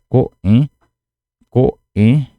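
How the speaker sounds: "coen" mais alto e agudo, cortado entre "co" e "en"; pronúncia cortada. Shown as a demonstration. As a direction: demonstre "coen" com pausa forte depois de "co". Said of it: "Viram a diferença?". ("coen" bem separado, "co", corte, "en")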